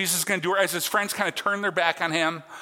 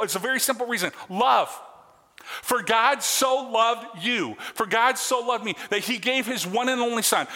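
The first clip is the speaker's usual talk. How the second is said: higher-pitched, emphatic sermon delivery; fervent preaching; 5 semitones higher than usual. Real, not acted. Preacher telling the congregation why Jesus suffered, fervently preaching. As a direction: press each question and answer home to the congregation.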